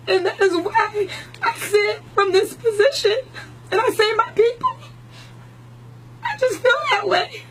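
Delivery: holding back tears, voice climbing; tearful. Real, not acted. Host's guest choking up while answering [name], tearful. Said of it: "I didn't mean to cry".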